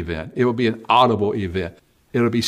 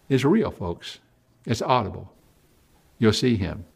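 usual speaking voice

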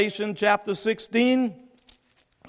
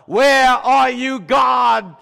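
loud, slow, crying out to heaven; anguished call; 4 semitones higher, 7 dB louder, much slower than usual. Acted. Preacher voices the world's cry to God, loud anguished call.

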